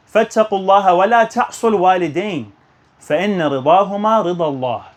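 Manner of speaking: quick, practiced recital of the hadith in Arabic; rapid Arabic recitation. Shown as a demonstration. Preacher reciting the narration to the congregation, rapid recital. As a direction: rattle off the memorized narration in one breath. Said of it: "the narration says".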